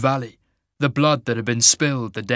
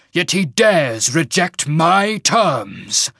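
loud, raised voice, slowing into indignation; furious shaman; 5 semitones higher, 6 dB louder, slower than usual. Acted. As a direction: mage lord seething at defiance.

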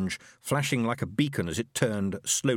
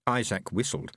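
ordinary delivery

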